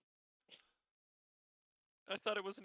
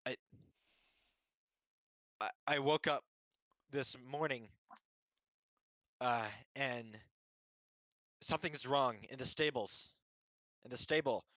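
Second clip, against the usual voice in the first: lightly voiced stable boy; 3 semitones higher than usual. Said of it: "he's like".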